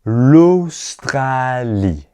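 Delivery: l'Australie said very slowly; slow teacher model of l'Australie. Shown as a demonstration. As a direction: draw out l'Australie. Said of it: "Répétez".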